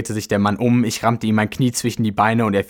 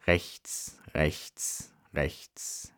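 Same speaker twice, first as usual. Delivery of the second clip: soft, isolated words trailing away; barely voiced, dazed counting-off; hushed fading; 3 semitones lower, 10 dB quieter, much slower than usual. A narrator's reading. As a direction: murmur each direction slowly, as if drifting off. Narrator's head spinning, story fades out.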